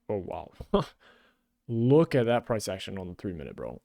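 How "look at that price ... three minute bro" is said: normal talking voice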